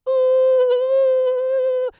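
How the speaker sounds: trembling high howl with tight lips; shivery howl. Shown as a demonstration. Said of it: "It's gonna shiver".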